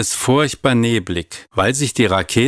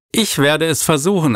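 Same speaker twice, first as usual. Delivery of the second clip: firm voice; 7 semitones higher than usual. A narrator's reading. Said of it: "mit fester Stimme".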